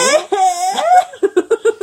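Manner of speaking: high, loud laughing shriek; shocked auntie. Pretend. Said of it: "「あら、やだー」とか".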